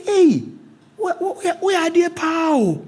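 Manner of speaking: high, excited calling out; animated retold outcry. The old man calling out where they are, high and animated. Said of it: "Like that, like that".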